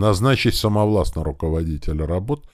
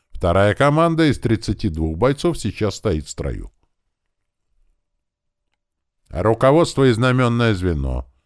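formal, slightly raised military report; officer reporting on parade; 6 semitones higher, 3 dB louder than usual. A narrator's reading. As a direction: deliver the troop count to your commander. (строевой доклад офицера)

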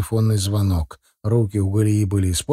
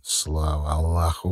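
low, slow, softer private thought; hushed thanks, pitched well down; inwardly relieved; 7 semitones lower, 5 dB quieter, slower than usual. A narrator's reading. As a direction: think it to yourself in quiet relief. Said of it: "мысленно обрадовался Фердаус".

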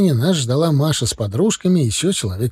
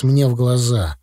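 usual speaking voice

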